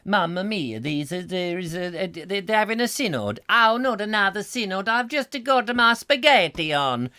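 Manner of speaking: a Roman groaning about another synod in mock Italian accent. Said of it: "I can't do the Rome-, uh, the Italian".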